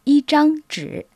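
yi zhang zhi dit haut et clairement, en mandarin; prononciation chinoise. Shown as a demonstration. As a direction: montrer le mandarin en détachant les syllabes. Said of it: "Il se dit en chinois zhang". (yi zhang zhi prononcé comme en chinois)

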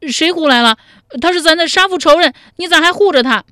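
哭喊着，声音又高又响，语速很快; 边哭边冲着哥哥嚷; 哭着说. A narrator's reading. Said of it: "一边哭着说". (又哭又喊地质问哥哥)

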